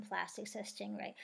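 usual speaking voice

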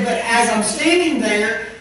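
fervent preacher build; rising preaching intensity. Acted. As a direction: push the voice up as the vision peaks. Dream climax preached with rising force.

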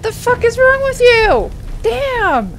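real high, loud outburst; exasperated shout. Real, not acted. Player yelling at a defeated game enemy, exasperated shout. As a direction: yell it high, dropping off on the last word.

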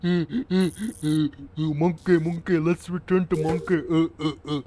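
high, loud hooting monkey noises; monkey impression. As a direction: hoot like a dumb monkey. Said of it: "one of you guys in my Discord chat".

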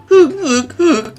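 high, short fake sobs; squeaky pretend sobbing; mock sob. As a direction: play at sobbing in sharp little gasps. Mock sobs over unwatched video.

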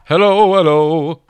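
drawn-out hello called into the mic; slow loud hello. Shown as a demonstration. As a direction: call it out slowly and loudly for the recording. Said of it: "go ahead and record my voice".

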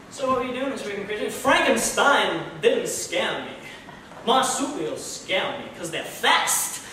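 slow, loud and deadpan, with weird pauses; Christopher Walken. Retold or imitated. Walken chats to a friend, very slow and deadpan. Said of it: "It's Christopher Walken".

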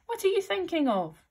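high-pitched scolding question; severe. A narrator's reading. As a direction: demand it severely, pitched right up.

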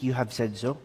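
soft, low, weak "you have said so"; timid, barely voiced "you have said so"; weak reply. Shown as a demonstration. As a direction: say "you have said so" faintly, without conviction. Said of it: "It was not a weak".

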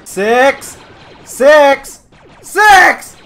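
searching yell building to a full shout. Real, not acted. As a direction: shout across the battlefield for a lost soldier. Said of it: "I'm just yelling sex now".

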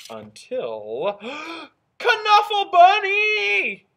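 slow, high, loud cry of joy; excited gasp. A narrator's reading. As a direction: gasp then cry out the name. Joyful discovery in story.

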